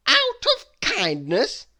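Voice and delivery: loud, high, slow mocking cry of "kindness!"; scornful exclamation. Mister Mean scoffing at Mister Worry, loud and mocking. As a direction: throw the word back in scorn. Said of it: "exclaimed Mister Mean".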